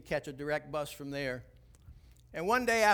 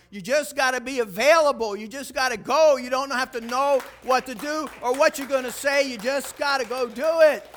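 much higher and much louder exhortation; full force preaching; 8 semitones higher, 11 dB louder than usual. Real, not acted. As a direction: shout the call to the congregation.

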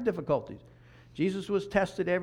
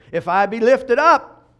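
loud, slow, raised declaration; ringing proclamation; 5 semitones higher, 12 dB louder, slower than usual. Real, not acted. Preacher quoting Jesus as the key, loudly proclaimed. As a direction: proclaim the verse at full voice, drawn out.

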